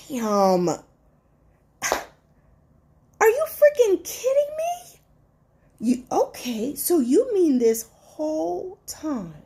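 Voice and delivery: high, cracking disbelief on freaking kidding me; stunned, almost crying in shock. Retold or imitated. Betrayed friend realizing the truth, shocked and hurt. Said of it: "it's like".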